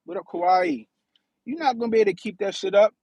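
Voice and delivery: usual speaking voice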